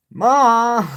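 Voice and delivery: loud call for mom. Pretend. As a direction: yell for mom like a kid. Calling out to mom.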